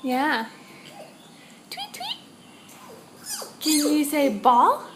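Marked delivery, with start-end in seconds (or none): 1.7-2.2 very high, light birdsong imitation; bird chirp. Shown as a demonstration. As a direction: chirp it like a little bird for a toddler. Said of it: "you hear the birds out there".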